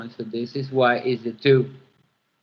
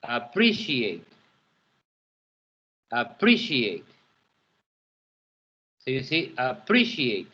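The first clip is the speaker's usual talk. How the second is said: "appreciate" said with the second syllable, pre, stressed; 2 semitones lower than usual. Shown as a demonstration. Teacher models stress for students, "appreciate" leaning on pre. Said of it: "the second syllable is the stress one".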